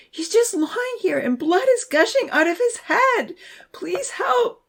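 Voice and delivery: put-on high, frantic girl's voice pleading for help; frightened bystander, high and pleading; crying for help. A narrator's reading. A girl calls for help over the injured boy, high and frantic. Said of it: "someone was crying".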